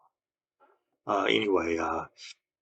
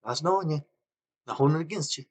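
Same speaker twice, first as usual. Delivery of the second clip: imitating boss; 8 semitones higher than usual. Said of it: "He says".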